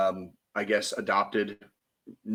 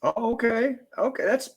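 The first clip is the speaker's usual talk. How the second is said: surprised okay; 11 semitones higher than usual. Real, not acted. Amused surprise at moon property.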